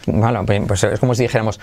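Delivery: usual speaking voice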